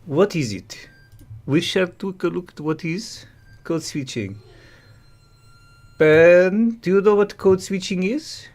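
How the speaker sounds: pompous mock French intellectual; English in a thick put-on French accent. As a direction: present it like a French TV host.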